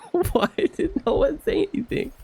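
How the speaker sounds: very high, wheezing laugh-cry; helpless laughter. Real, not acted. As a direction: laugh so hard the voice breaks.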